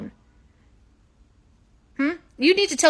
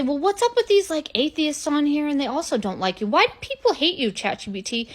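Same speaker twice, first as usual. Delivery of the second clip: pitched-up, wide-eyed asking; high mock-innocent question; 5 semitones higher than usual. [name] asking ChatGPT in a high naive voice. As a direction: ask it all sweet and high.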